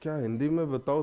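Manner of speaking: ordinary delivery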